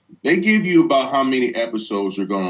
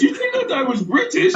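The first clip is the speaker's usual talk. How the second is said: retold incredulous question. Retold quote in dinner story.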